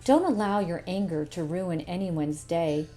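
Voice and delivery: ordinary delivery, nothing marked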